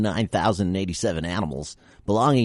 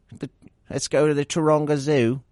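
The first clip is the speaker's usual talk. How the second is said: higher, hammed-up attempt at an Australian accent; a clumsy mock Australian accent with a raised pitch; bad Australian accent; 8 semitones higher than usual. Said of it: "I don't have a very good Australian accent".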